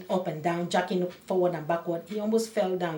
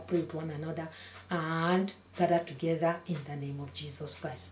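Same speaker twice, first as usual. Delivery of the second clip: ordinary talk, nothing marked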